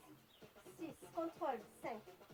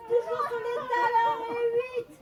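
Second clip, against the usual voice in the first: strained effort voice; 4 semitones higher, 7 dB louder than usual. Real, not acted. Coaching while doing hard exercise.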